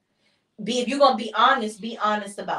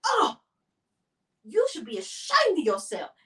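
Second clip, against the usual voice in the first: high-pitched mock outrage; hammed-up, scolding dismay; dramatic disgust; 8 semitones higher than usual. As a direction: overplay the scandalized husband. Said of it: "He's very dramatic".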